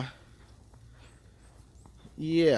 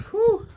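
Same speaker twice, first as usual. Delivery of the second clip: high, loud whew; 8 dB louder than usual. Real, not acted. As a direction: blow out a big whew. Reacting to the cold.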